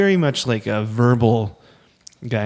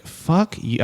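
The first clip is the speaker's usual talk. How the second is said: mock-angry retort, pitched up; a higher-voiced retold curse; retold anger; 7 semitones higher than usual. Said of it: "I got so mad at him".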